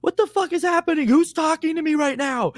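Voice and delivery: high, bewildered cry at an unseen voice; panicked confusion. Retold or imitated. Man alone on a rooftop hears voices, high panicked confusion. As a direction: ask it high, as if losing your mind. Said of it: "rooftop in Brooklyn at four in the morning going".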